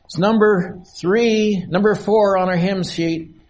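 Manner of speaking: ordinary talk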